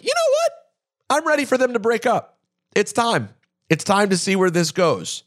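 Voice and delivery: high-pitched, emphatic change of heart; retold fed-up resolve. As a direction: re-enact deciding you've had enough, starting very high and easing down. Voicing his changed mind as quote.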